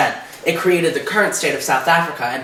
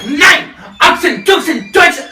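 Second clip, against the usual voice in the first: much higher, loud ranting German; Hitler impression; 13 semitones higher, 9 dB louder, slower than usual. Comic actor playing Hitler, ranting loud mock German. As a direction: rant in mock German like a dictator at a rally. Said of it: "go be Hitler for a sec".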